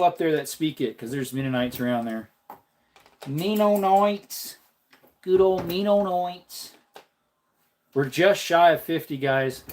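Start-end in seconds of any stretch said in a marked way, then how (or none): 3.3-4.6 "Mennonites" drawn out high as "mee-no-noits". Shown as a demonstration. Streamer echoing the word "Mennonites", high slow accented "mee-no-noits". 5.2-6.7 high, slow accent with "Mennonites" as "mee-no-noits". Shown as a demonstration.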